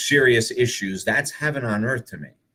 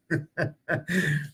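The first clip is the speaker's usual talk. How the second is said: breathy high giggle; a quiet high laugh at his friend's joke; soft high laugh; 7 semitones higher, 6 dB quieter than usual. Real, not acted. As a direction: let a quiet laugh slip out.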